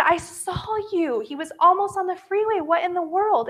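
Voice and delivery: high-pitched, exasperated protest; reenacted upset argument; retold freak-out. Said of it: "And I was freaking out".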